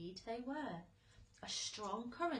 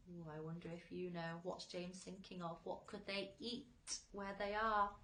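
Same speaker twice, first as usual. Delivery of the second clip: plain reading voice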